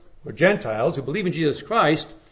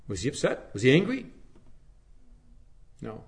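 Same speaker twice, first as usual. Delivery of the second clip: ordinary talk, nothing marked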